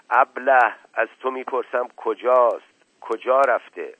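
irate master repeating his question; exasperated scolding. Acted. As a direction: lose patience with a dim servant. Uncle insults and interrogates servant.

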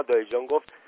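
ordinary delivery, nothing marked

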